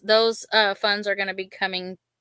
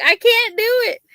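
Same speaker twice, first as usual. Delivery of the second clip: much higher and louder, a whiny wail; imitating a helpless, panicked refusal; mock panicked whine; 6 dB louder than usual. Imitating mother-in-law who won't grab the chick, panicked whine. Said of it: "And she's like".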